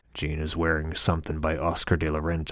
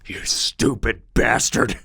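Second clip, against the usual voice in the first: angry insult; 6 semitones higher, 5 dB louder, slower than usual. A narrator's reading.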